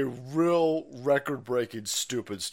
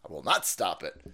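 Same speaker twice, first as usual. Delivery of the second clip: ordinary talk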